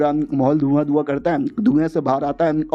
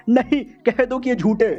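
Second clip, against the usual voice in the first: much higher, strained disbelieving cry; shocked disbelief; 7 semitones higher than usual. Acted. Villain hears the girl will marry the hero, high shocked disbelief. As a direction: beg it not to be true.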